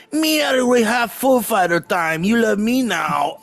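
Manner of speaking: put-on high, broken-English Swedish frontman accent; mock foreign accent. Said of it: "That's that guy's voice".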